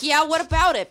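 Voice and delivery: loud, challenging retort; defiant comeback. Retold or imitated. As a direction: throw it back like picking a fight. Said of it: "And I was like".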